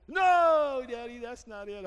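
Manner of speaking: put-on high, loud child's no; little girl. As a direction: answer like an eager toddler. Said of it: "daughter answers dad".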